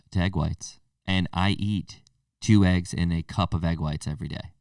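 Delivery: usual speaking voice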